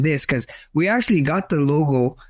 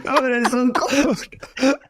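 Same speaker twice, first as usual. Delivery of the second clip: laughing disbelief; 8 semitones higher, much slower than usual. Retold or imitated. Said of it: "I was like".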